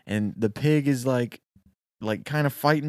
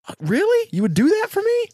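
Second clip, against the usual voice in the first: much higher, louder, thrilled naive voice; gullible excited worker; 10 semitones higher, 4 dB louder than usual. Acted. Storyteller voicing the naive worker trading away his gold, high and giddy. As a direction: gush like a fool who can't believe his luck.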